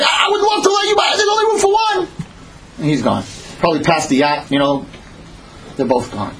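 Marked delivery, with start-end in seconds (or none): 0.0-2.1 very high yell across the wind; shouted refusal. Acted. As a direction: holler the apology while passing. Refusal yelled back in storm.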